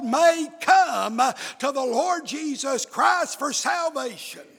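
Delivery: driving revival sermon cadence; full-force preaching. Real, not acted. Preacher to congregation on the wages of sin, full-force preaching. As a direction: preach it hard, phrase by phrase.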